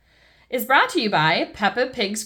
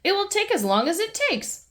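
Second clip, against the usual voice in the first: high, matter-of-fact workman voice; a cheerful workman who won't be rushed; Mister Bull's voice; 11 semitones higher, faster than usual. Acted. Mister Bull telling Mummy Pig the job takes its time, high and matter-of-fact. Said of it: "says Mister Bull".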